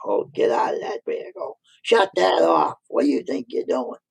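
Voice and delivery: mock frantic shouts to a kid on the tractor; hammed-up panicked boss; frantic yelling. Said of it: "he went totally fran-, frantic".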